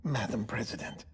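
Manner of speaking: hushed, softly addressing the dying president; respectful bedside whisper. Real, not acted. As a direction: whisper so as not to startle her. Entering dying president's room.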